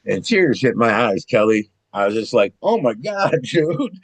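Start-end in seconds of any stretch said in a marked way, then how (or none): 2.6-4.0 higher-pitched, astonished exclamation; retold amazement. [name] hears of late mother's visit, retold amazement. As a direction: act out being floored by the news.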